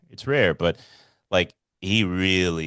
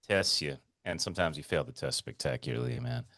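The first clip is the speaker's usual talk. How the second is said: ordinary delivery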